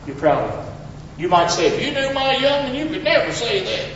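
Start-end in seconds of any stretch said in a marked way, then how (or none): 1.7-3.9 high-pitched mock protest; higher, put-on objection; objecting parent. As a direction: imitate a parent protesting in a raised voice.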